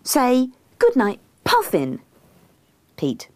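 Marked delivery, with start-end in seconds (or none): 0.0-2.0 slow, high, pointed instruction; insistent prompting. A narrator's reading. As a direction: spell it out for him to repeat. Coaxes him to say it.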